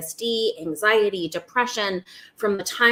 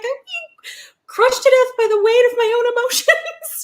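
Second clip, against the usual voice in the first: hammed-up high, loud despair; mock panic; 7 dB louder than usual. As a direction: wail it as comic overwhelm. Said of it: "panic state where you're like".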